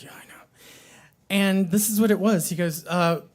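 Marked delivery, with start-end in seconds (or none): 0.0-0.4 soft whispered reply. Retold or imitated. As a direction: breathe it under his nose. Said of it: "he was like".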